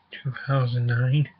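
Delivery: ordinary delivery, nothing marked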